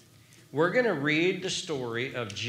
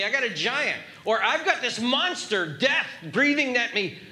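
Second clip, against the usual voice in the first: raised, pressing voice asking if it's real; urgent questioning; 9 semitones higher, 3 dB louder than usual. Pretend. Preacher urging the congregation to question, raised and pressing. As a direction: press the doubt hard, like facing a giant.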